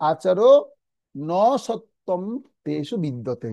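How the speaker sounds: আচর, then a drawn-out ন সত্যম, then তেষু বিদ্যতে; broken word by word. Shown as a demonstration. Teacher modelling split pronunciation, আচর ন সত্যম slowly divided. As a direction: say আচর ন সত্যম in slow separate pieces. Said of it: "যদি ভেঙে ভেঙে উচ্চারণ করি".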